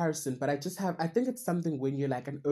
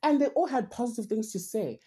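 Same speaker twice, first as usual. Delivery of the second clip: ordinary delivery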